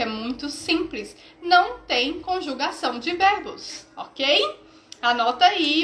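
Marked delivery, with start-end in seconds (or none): none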